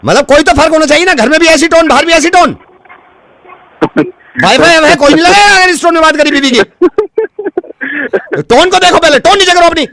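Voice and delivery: very high-pitched, relentless mock fury; outraged rant. Prank caller forbidding the customer that tone, furious. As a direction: lay down the law in a high, worked-up voice.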